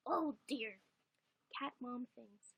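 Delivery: normal talking voice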